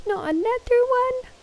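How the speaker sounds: imitating alert voice. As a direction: parrot the sound alert, way up high. Echoes the alert clip's voice.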